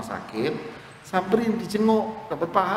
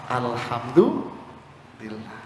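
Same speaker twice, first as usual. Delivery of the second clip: serene acceptance; 5 semitones lower, much slower than usual. Acted. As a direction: thank God unshaken. Shop owner calm after fire.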